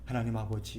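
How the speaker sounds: soft, quiet calm address; a hint of a soft calm prayer. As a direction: say it calmly and quietly. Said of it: "차분하게".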